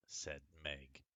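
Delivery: lively storybook reading; story reading. Acted. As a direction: read it like a picture book to children. Reads a picture book aloud.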